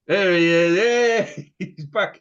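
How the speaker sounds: high, loud welcome; excited greeting. Real, not acted. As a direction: cheer him back in. Missing co-host returns.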